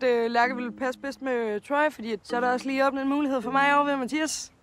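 ordinary talk

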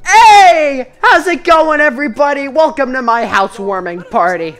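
high and loud host hello; hammed-up welcome shout; party host greeting. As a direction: greet the guests over the noise.

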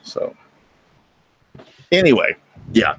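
usual speaking voice

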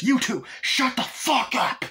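high, slow, loud threat; a drawn-out screamed threat; a robber threatening a terrified couple, high and slow; robber yelling. Retold or imitated.

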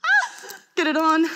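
high, loud strained yelp; frantic struggle; flustered struggle. Retold or imitated. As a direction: fight the skirt clasp. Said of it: "So I was going".

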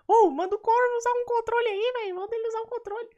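very high-pitched cartoonish pestering voice; imitating live-chat viewers. Streamer mimicking chat urging a controller, squeaky pestering voice. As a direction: squeak it like a nagging fan. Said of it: "todo mundo falando pra eu usar controle".

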